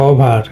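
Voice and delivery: ওভার with a plain short o; common mispronunciation. Shown as a demonstration. Teacher shows students how we usually say it, common mispronunciation. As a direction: say ওভার the everyday Bengali way, single o. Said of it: "আমরা এটাকে বলি".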